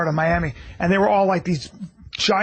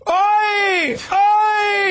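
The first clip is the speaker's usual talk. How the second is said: high, drawn-out battle cries; imitated fight yell; 12 semitones higher than usual. Retelling Mayhem beating a gang of guys, high shouts. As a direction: let each yell ring out long. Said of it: "he was going".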